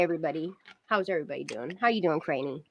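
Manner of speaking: soft, low hello to the chat; quiet greeting. Real, not acted. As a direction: greet them gently, almost under your breath. Repeats greeting to viewers.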